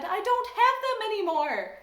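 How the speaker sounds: high and loud protest. Acted. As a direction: insist on it, upset. Girl protesting to principal.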